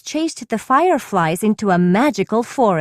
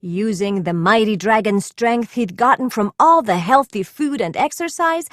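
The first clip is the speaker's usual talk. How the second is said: ordinary delivery, nothing marked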